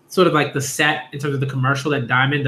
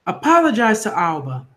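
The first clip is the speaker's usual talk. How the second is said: higher-pitched acted scolding; stern order; 6 semitones higher than usual. Mother telling Taka to apologize to Alba, stern high order. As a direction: order it like a cross parent, pitched up.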